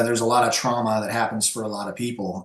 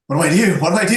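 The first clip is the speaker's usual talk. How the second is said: higher and louder, repeating in a rush of panic; panicked thought; 6 semitones higher, 8 dB louder, much faster than usual. Retold or imitated. Guest recalling his panic at a friend's family fight, higher and louder. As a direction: blurt it out like you're watching a fight break out and don't know what to do. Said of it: "And I'm like".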